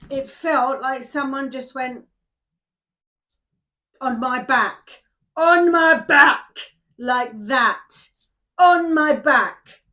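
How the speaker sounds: very loud, slow, emphatic cry; startled outburst. Real, not acted. Card reader tells viewers what touched her back, loudly rattled.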